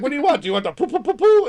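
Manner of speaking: high, playful sing-song "poo, poo"; baby talk. Retold or imitated. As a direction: ask like coaxing a small child. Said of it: "she's like".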